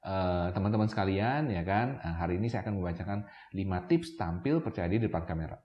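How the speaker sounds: low, soft, monotone read-out; unconfident reading aloud. Shown as a demonstration. Showing camera beginners what not to do, low lifeless reading. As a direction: recite it quietly with no emphasis, hesitant fillers. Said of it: "misalnya saya ngomongnya gini".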